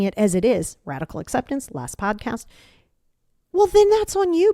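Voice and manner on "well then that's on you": much higher and louder, punched out; emphatic. Real, not acted. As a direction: throw it right at them, much higher and louder. Pushing listener to own it.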